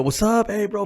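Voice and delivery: high, loud friendly hey bro; high cheery hello to a client; upbeat greeting. Retold or imitated. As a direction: greet them like a buddy.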